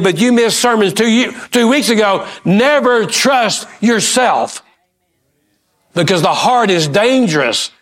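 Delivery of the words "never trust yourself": slow warning. Real, not acted. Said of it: "preacher drives the warning home".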